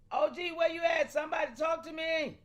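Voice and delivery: loud call to the chat, shouting for a reply; hollering for the chat to respond. Real, not acted. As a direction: shout out to a friend who isn't answering. Calling out to chat members.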